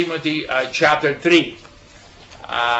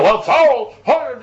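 mock angry tour guide; 7 semitones higher, 4 dB louder, slower than usual. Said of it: "he was mad".

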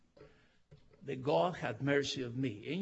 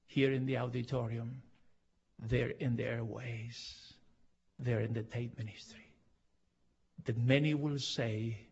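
closing prayer. Real, not acted.

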